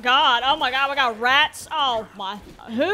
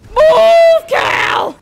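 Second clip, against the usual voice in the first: frantic shout; 14 dB louder than usual. Real, not acted. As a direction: yell it much higher and much louder. Pushing a character away in game.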